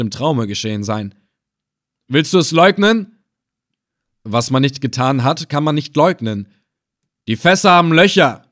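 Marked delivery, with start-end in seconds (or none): 2.1-3.2 sharp, higher, louder demand; angry questioning. A narrator's reading. Captor questions prisoner who denies it, angry questioning. Villain interrogates bound narrator. 7.3-8.4 raised, forceful charge; angry accusation. A narrator's reading. Captor confronts prisoner over barrels, angry accusation. Villain accuses bound narrator.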